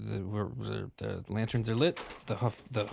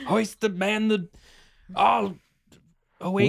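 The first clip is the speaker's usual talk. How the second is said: high, loud sailor call; shouted command; 9 semitones higher, 6 dB louder than usual. Acted. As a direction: bellow orders across the deck.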